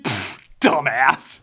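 slow, scornful scoff; dismissive pfft. Acted. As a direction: scoff it out with contempt. Mocking the viewer's assumption.

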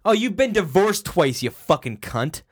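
loud mocking rant; swearing tirade. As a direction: spit it back at them. Mock rant at divorced relative.

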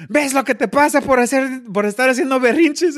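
regañando como mamá mexicana; scolding mom. Retold or imitated. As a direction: scold him for his tantrum. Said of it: "and she goes".

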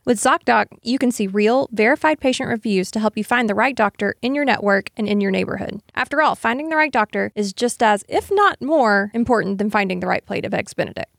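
upbeat sponsor read. Acted. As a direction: sell it to the listeners. Reads a sponsor ad.

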